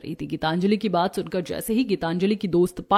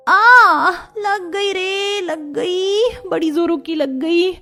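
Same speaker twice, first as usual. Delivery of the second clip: high, drawn-out ouch; slow, high complaint of pain; pained whining; 9 semitones higher, much slower than usual. A narrator's reading. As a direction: whimper about the hurt. Gauri falls on plants.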